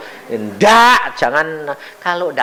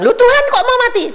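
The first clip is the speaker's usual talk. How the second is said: frantic protest; 3 dB louder, much faster than usual. Retold or imitated. Uncle protesting to God.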